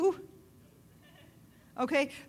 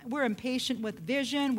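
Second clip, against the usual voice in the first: ordinary talk, nothing marked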